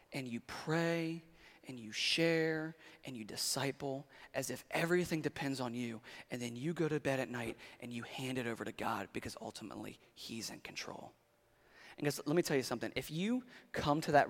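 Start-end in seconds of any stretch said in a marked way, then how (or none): 0.1-1.2 hushed sermon aside. Acted. Sermon point turned intimate. 1.7-6.0 softer, whispered sermon line; hushed whisper. Acted. Contrast with earlier line 10. 6.3-11.1 hushed sermon aside. Acted. Sermon point turned intimate.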